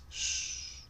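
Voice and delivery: soft long shh; soothing shush. A narrator's reading. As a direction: hush a crying child. Hace callar al niño. (un shh suave para calmar)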